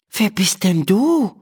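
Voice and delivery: put-on high, wondering girl's question; astonished. A narrator's reading. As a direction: ask in wide-eyed surprise. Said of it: "fragt Emma erstaunt".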